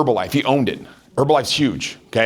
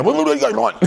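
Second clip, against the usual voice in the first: mentor's gruff question; 10 semitones higher than usual. Retold or imitated. Mentor voiced in anecdote.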